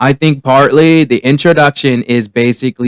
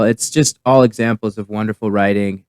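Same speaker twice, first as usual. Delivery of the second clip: normal talking voice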